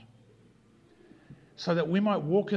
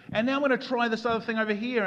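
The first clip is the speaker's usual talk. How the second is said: hurried mock eagerness; 7 semitones higher, 3 dB louder, faster than usual. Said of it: "it didn't matter what decision I made".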